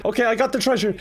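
ordinary delivery

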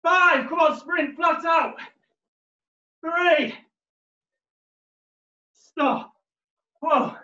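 high, loud coach yelling; shouting encouragement. Real, not acted. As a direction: fire them up for the last sprint. Final sprint, coach yelling encouragement.

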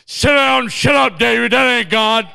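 loud mimicked rebuke; mocking the father-in-law's shout; indignant retelling. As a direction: throw his order back, loudly. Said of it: "I can't believe he told me".

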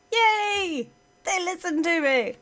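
high, bright burst of joy; delighted cheer. Real, not acted. Gamer cheering as units obey. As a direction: celebrate a small win.

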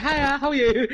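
mock flirty phone voice; suddenly really nice. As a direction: switch from angry to sweet in an instant. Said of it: "my voice went from, like, being really pissed off to, like, really nice".

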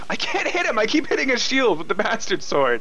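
high-pitched frustrated whine; venting helplessly at the game; exasperated complaint. Real, not acted. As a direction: start very high, whining in real frustration. Exasperated at repeatedly hitting shield.

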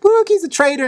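high, hammed-up exclamation; mock accusation. Leonidas exposing the traitor, high mock accusation. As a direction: cry it out high, pointing the finger. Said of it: "and he's like".

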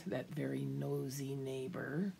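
a secretive stage whisper about the neighbor; whispered aside. A narrator's reading. Storyteller whispering to the kids, a sly whispered aside. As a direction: lean in and whisper it like gossip. Narrator's gossipy aside to children.

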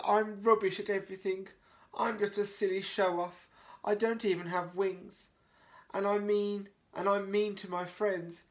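high, tearful apology; mild sob through the sorries; crying dog. Acted. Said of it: "Oh no, he's crying".

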